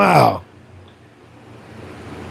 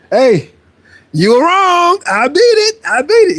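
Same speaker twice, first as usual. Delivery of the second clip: much higher, teasing voice for you were wrong; high taunting gloat; 11 semitones higher, slower than usual. Real, not acted. Gloating at doubters in chat.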